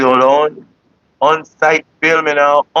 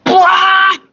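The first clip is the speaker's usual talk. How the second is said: high, loud squeak of disbelief; mock stunned yelp; shocked what; 5 dB louder than usual. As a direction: act floored by it. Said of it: "I was like".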